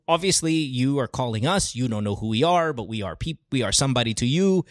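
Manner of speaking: ordinary talk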